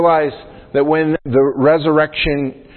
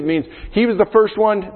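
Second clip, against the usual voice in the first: usual speaking voice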